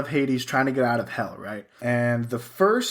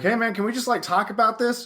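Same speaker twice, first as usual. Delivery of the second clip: higher-pitched mock appeal; imitating a plea to talk; 9 semitones higher than usual. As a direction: play a guy trying to reason, pitched up. Acts out Zagreus pleading to talk.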